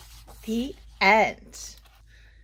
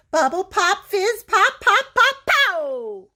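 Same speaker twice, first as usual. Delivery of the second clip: building pops; 9 semitones higher, 6 dB louder than usual. A narrator's reading. Mixture fizzing up.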